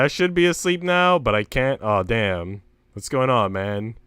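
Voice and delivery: usual speaking voice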